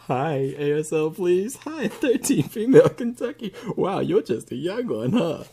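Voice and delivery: high, put-on flirty chat voice; mock chat voices. Acts out the chat messages.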